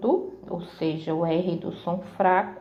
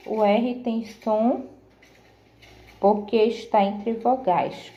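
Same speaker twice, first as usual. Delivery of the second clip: ordinary talk, nothing marked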